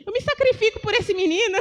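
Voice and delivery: mock her own grumbling prayer; lightly acted lament; complaining to God. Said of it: "Eu comecei a me queixar".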